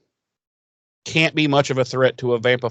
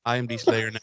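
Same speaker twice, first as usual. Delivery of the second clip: softer mock movie hijacker voice; Captain Phillips parody; 2 semitones lower, 6 dB quieter, faster than usual. Host repeats the Slayer takeover joke, Captain Phillips parody. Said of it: "that actor".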